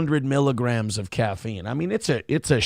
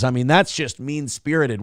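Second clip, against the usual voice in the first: ordinary talk, nothing marked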